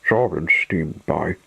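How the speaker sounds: usual speaking voice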